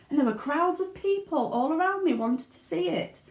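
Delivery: put-on high, eager child's voice tumbling out; a boy bursting with his big news; excited boy Charlie.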